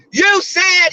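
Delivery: high, loud mock yell of a loud coworker; shrill it out like a loud coworker mid-argument. Coworker yelling in an argument, mocked with a high loud yell. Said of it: "start yelling like".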